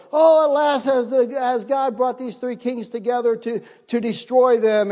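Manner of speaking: high, loud wail of a despairing king; lamenting king. Acted. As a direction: cry out like a doomed king, slowing at the end. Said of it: "the king of Israel is going".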